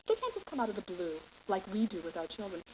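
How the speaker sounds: ordinary delivery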